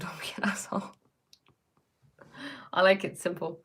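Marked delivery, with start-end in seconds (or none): 0.0-0.9 laughing whisper. Real, not acted. Cheeky whispered punchline with a laugh.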